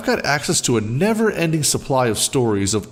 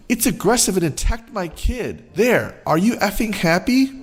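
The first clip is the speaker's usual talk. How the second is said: high, exasperated mom voice; lightly voiced angry mother; entitled mom; 8 semitones higher than usual. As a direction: throw the lie out in a huff. Entitled mom lies angrily.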